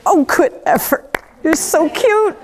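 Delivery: high, slow, patronizing coo; a horse gently teasing its human; amused horse. Retold or imitated. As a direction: humour a clumsy beginner kindly. Horse humouring a clumsy human.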